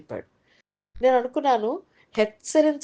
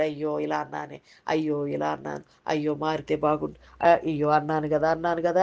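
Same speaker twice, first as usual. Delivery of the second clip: low, soft self-reproach; subdued, understated regret in a lower voice; murmured regret; 6 semitones lower, 4 dB quieter than usual. Acted. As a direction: lightly voice someone regretting their words. God voiced regretting harsh words.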